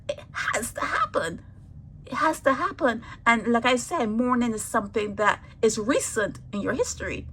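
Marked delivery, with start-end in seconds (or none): 0.1-1.4 unvoiced whisper, said as a hushed certainty. Real, not acted. Speaker telling mourners it is inevitable, whispered. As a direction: whisper it like an undeniable truth.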